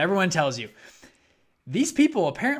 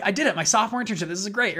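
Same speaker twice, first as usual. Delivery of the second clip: high, louder proud excitement; excited; 6 semitones higher, 4 dB louder, faster than usual. Retold or imitated. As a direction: celebrate the win. Retelling thrilled inner reaction.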